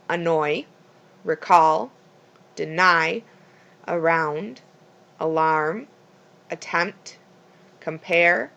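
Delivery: second syllable stressed: "annoy, recall, deny, around, alarm, attempt, compare"; iambic word stress. Shown as a demonstration. Teacher listing naturally iambic words, "annoy" to "compare" stressed on second syllables. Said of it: "Some naturally iambic words".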